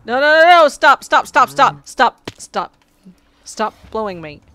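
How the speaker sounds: loud, high frantic no and stop, fading toward the end; panicked shouting. Real, not acted. Player being blown away by a mob, frantic shouting. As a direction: yell in real panic as things go wrong.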